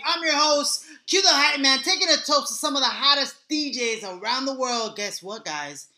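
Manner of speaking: loud, high hype-man show opener; hype man intro. Acted. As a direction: pump up the crowd like a party host.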